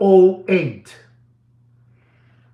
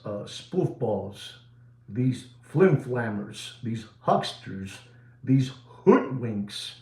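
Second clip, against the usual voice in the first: much softer, slow contemptuous listing; scornful hush; 6 semitones lower, 8 dB quieter, slower than usual. Acted. Host sneering at watch hucksters, soft slow scorn. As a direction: spit out the insults low and slow. Mocking the hype sellers.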